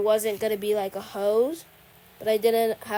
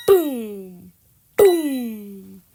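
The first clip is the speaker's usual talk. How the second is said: Vine boom sound; 10 dB louder than usual. Pretend. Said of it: "sounds like the Vine boom sound".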